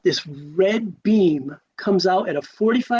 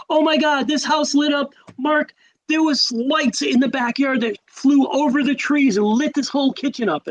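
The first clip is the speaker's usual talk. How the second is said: much higher, excited retold voice; imitating alarmed mother; 9 semitones higher than usual. Mother telling her son about lights over the trees, imitated alarm. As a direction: act out an astonished mom, pitched well up.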